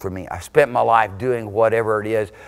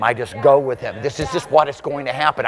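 usual speaking voice

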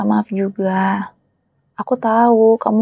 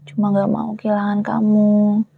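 ordinary delivery

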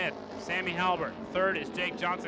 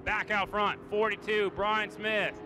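ordinary delivery

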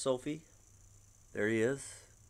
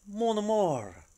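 higher, gushing French greeting; 8 semitones higher, 4 dB louder, slower than usual. Acted. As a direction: coo it like a fawning French fan. Partygoers gushing over Charlie.

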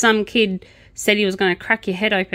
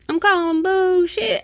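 higher, slow Southern American twang; American Southern accent; 11 semitones higher than usual. Retold or imitated. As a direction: drawl it like a Southern American judge. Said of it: "And Southern too".

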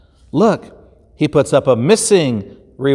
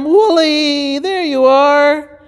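much higher, slow joyful relief; relieved shepherd; 13 semitones higher, 3 dB louder, slower than usual. Acted. Shepherd finding his lamb, joyful and slow. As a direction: sigh it out with delight.

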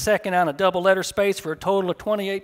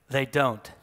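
a hint of a German accent, lower; just a touch of German accent; light German accent; 6 semitones lower, 6 dB quieter than usual. A narrator's reading. German wife answering Charlie at the radio station, lightly accented. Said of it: "That an accent I hear?".

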